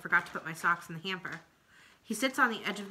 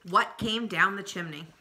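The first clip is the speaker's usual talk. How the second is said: ordinary delivery, nothing marked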